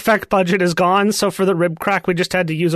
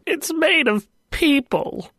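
dramatic movie quote; 10 semitones higher, slower than usual. Pretend. Soylent Green movie quote.

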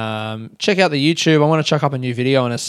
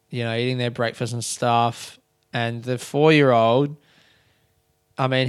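ordinary delivery

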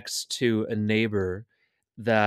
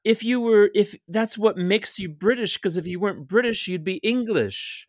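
higher, insistent mock lecture; laying it out for a mate, pitched up and pushy; retold lecture; 7 semitones higher than usual. Retelling an argument with a gaming friend, pitched-up insistent lecturing. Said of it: "And I said".

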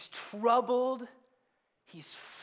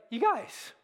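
frustrated Jesus; 3 semitones higher than usual. Retold or imitated. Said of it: "I mean, he's like".

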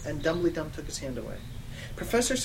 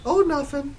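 high, sugary innocence; sweet; 10 semitones higher, 6 dB louder than usual. Acted. Girl covering her slip to Satan, sweet. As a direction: brush it off sweetly, pitched up. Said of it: "I said sweetly".

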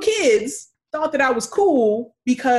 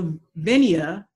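usual speaking voice

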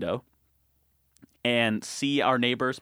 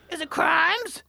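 very high, excited question; high-pitched teasing; slower than usual. Retold or imitated. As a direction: squeak the question to wind up a dog. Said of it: "the higher pitch my voice gets".

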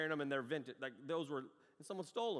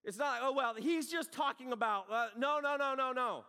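loud, higher, insistent no after no; fiery rebuttal; 8 semitones higher, 5 dB louder than usual. Acted. Preacher refusing the congregation's excuses. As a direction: shut the excuse down hard.

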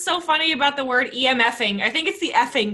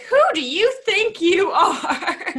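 drawn-out, higher-pitched mock outrage; indignant comeback; 5 semitones higher, slower than usual. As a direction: slowly stretch it out, incredulous and half laughing. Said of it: "I would be like".

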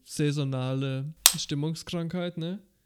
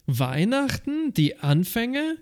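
grand announcer; 6 semitones higher, slower than usual. Pretend.

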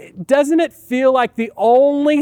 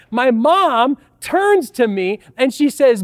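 ordinary talk, nothing marked

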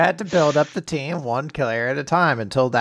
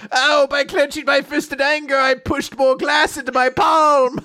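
sputtering foiled villain; 9 semitones higher, 3 dB louder than usual. Pretend. Adds to the villain parody.

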